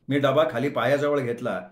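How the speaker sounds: ordinary delivery, nothing marked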